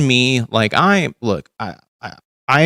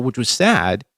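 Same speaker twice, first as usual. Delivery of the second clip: normal talking voice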